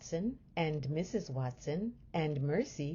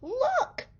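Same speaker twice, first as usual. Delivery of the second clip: very high, louder exclamation; wife spotting the pig running off; excited cry; 4 dB louder than usual. A narrator's reading. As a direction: point it out eagerly. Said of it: "said Missus Watson".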